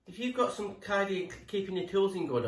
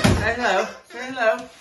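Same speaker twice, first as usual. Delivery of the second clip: higher, louder call to a pet; coaxing greeting; 6 semitones higher, 7 dB louder than usual. Real, not acted. Vlogger to his dog on camera, coaxing. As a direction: call out a hello on the dog's behalf.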